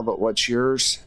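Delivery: usual speaking voice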